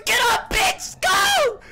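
frantic high shout; yelling in real panic; frat bro. Real, not acted. Friend caught by killer.

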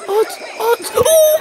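excited shout. Real, not acted. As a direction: yell with joy as you win. Game win, shouting. (heyecanla bağırarak)

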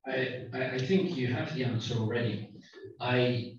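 usual speaking voice

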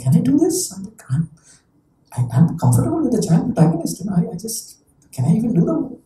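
self-doubting. Retold or imitated. Voices own hesitant self-questioning.

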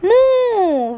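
long, high drawn-out moo; stretched cow imitation for children. Shown as a demonstration. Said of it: "Cow says".

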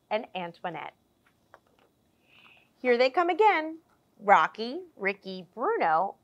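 lively picture-book storytelling voice; animated children's story narration; reading to children. A narrator's reading. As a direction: read it like story time for little ones. Reads picture book aloud.

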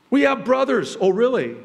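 high, loud urgent plea; loud pleading. Acted. Brothers pleading with the powerful Joseph, loud and high. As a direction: push the words out high and loud.

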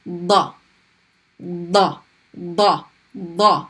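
Da repeated, tongue behind teeth; heavy emphatic Da drill. Shown as a demonstration. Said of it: "Your tongue should not be sticking out".

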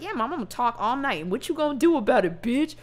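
fast, cocky mock comeback to mom; defiant talk-back. To his nagging mom, a quick cocky retort. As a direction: sass her right back, quickly. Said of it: "I would've been like".

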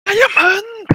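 shrill excited cry; excited high shout. Pretend. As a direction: yell it out thrilled. Excited diamond call.

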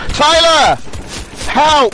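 yelling for help. Real, not acted. Calling teammate for help in game.

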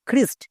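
"খ্রিস্ট" with an aspirated KH opening; "খ্রিস্ট" starting on a puffed খ; pronounced with KH. Shown as a demonstration. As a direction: say "খ্রিস্ট" as khrist. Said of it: "খ হয়, যেমন খ্রিস্ট".

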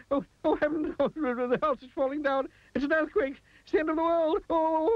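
shrill-high panic, ending in a long wail; trembling with fright. A narrator's reading. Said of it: "trembling with fright".

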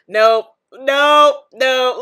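loud, firm refusals; emphatic loud nope. Real, not acted. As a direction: shut it down, loudly. Loudly rejecting bad sandwich.

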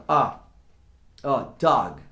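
"ó, dog" with the vowel like two As, mouth half closed; open O like two As. Shown as a demonstration. Teacher showing the American vowel to students. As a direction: shape "dog" as a long open ah. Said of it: "meio com a boca fechada".

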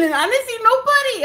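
high, amazed delivery; astonished. Real, not acted. Telling how nobody was there, high astonished. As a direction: rise up in disbelief.

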